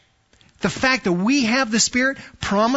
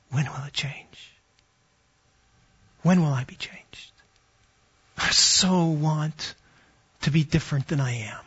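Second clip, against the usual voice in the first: whispered inward longing; 5 semitones lower, 4 dB quieter than usual. Shown as a demonstration. Preacher voicing a believer's private longing, whispered. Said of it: "this is the inward sigh".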